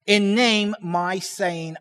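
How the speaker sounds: slow, deliberate reading in chunks; measured literal recitation, leaning on each phrase opening; word-for-word reading. Shown as a demonstration. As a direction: read it out slowly, pausing after the first word. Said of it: "reads like this".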